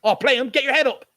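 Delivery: loud urgent yelling at players; shouting at the screen. Real, not acted. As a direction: yell at the TV. Shouting at the TV match.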